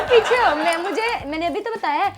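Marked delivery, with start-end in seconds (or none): 0.0-0.6 higher, louder shove-off; shooing away. Retold or imitated. Dismissing a stammering husband, loud and impatient. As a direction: snap at him to back off. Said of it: "मैंने कहा".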